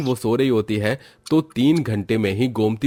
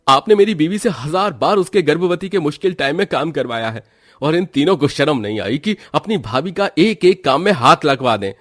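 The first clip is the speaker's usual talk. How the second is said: angry scolding; 2 semitones higher than usual. Acted. Son scolds his mother and sisters in hospital, angry. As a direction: tear into them for hurting his wife.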